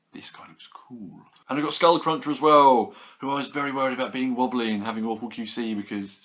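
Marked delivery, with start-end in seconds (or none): 0.1-1.3 whispered. Real, not acted. Whispered aside to the figure.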